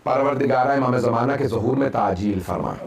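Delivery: low chanted prayer to end the majlis; closing prayer. Acted. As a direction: settle into the closing dua. Closing dua after the lament.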